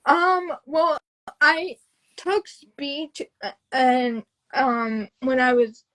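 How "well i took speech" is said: normal talking voice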